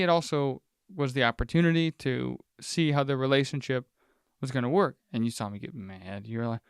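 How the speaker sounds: ordinary talk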